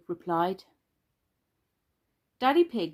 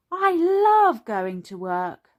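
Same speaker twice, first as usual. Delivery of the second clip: high, loud, slow and gleeful; calling out; 7 semitones higher, 6 dB louder, slower than usual. A narrator's reading. Peppa to Daddy over the helicopter, gleeful call. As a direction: stretch it out to carry over noise. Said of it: "called Peppa over the noise of the helicopter".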